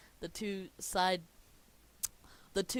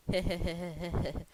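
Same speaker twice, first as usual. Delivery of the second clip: loud rapid heh-heh chuckle; Krabs's scheming little laugh; acted chuckle; 6 dB louder than usual. Krabs laughs holding the headphones.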